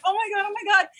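high, panicked gasps of oh my God; hammed-up panic on the phone; mild mock panic. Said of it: "I'm like".

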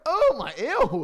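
high, loud disgusted ew. Retold or imitated. Remembering the spider-egg scene, a high loud disgusted ew. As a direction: recoil at the spider eggs. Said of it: "I was like".

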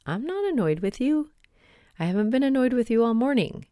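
high-pitched, mildly indignant retold protest; protesting denial. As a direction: imitate someone defensively insisting they are not annoyed. Retold husband protests he isn't annoyed.

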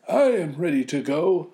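put-on high, loud old man voice; a hammed-up old grandfather, volunteering. Villager offering to go, as a high old man. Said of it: "an old man said".

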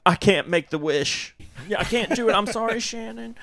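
high, pleading apology with a laugh; mock tearful little girl begging; sobbing girl's plea. Girl apologizing to Shannon, high and pleading. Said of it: "she's like".

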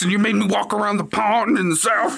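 hammed-up grumpy investigator; mock pissed complaint. Investigator Ken griping at psychic, mock pissed whine. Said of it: "Ken was just fucking pissed".

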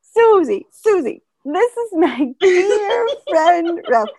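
put-on high, loud, slow quavery old voice; imitating grandma. Grandma interrupting relatives to present Ralph, quavery mock voice. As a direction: repeat it like a sweet old lady. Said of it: "anytime anyone started talking".

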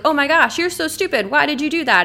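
acted-out judgmental outburst; harsh scolding. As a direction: berate someone in exasperation. Scolding one would never give another.

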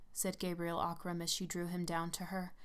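usual speaking voice

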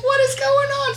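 high-pitched retold bewilderment; baffled alarm. Mom baffled by her screaming child, high alarm. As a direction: squeal it in mock panic. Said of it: "And I'm like".

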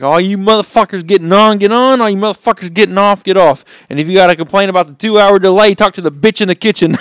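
loud, high mock conductor's announcement; calling out passengers. Little Johnny playing train conductor for passengers, hollered mock announcement. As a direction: holler it out to the whole platform, building to the punchline.

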